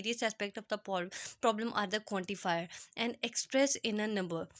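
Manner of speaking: reading the book line aloud, slowly and carefully; slow definition read. Shown as a demonstration. Teacher to students, reading out a slow quote. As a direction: dictate each word to the class.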